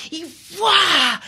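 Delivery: high loud whoosh; splash noise. Retold or imitated. Reporter imitating the whale landing, loud high whoosh. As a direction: burst out the splash sound.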